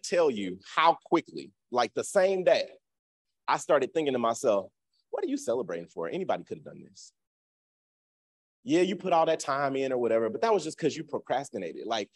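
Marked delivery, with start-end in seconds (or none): 5.1-7.1 low, soft inner-critic voice; quiet self-doubt. Retold or imitated. Thinking to himself after passing, quiet self-doubt. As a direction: deflate it with a low, dismissive murmur.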